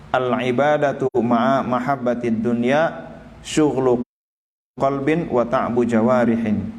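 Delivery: slow chanted Arabic reading; preacher reciting the Arabic text of the kitab; kitab recitation. Acted. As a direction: intone the classical text slowly.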